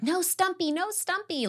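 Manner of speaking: high-pitched mock scream at reduced volume; thin, high reenactment of panicked screaming; imitated screaming. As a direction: squeal it like a held-back scream. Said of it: "like, just screaming".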